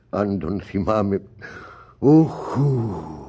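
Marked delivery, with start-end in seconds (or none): 2.0-3.2 sorrowful groan. Acted. Father remembering dead son.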